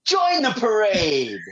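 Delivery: hammed-up announcer line; catchphrase. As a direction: quote the inside joke, cracking up. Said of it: "we just say".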